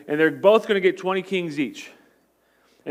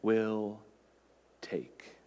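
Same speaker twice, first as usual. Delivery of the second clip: whisper; 8 semitones lower, 10 dB quieter than usual. Real, not acted. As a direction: drop to a bare whisper on take. Sermon climax, whispered ending.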